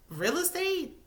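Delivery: high, questioning disbelief; incredulous echo. Pretend. Viewer doubting what she says he does, incredulous. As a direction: throw it back as a baffled question.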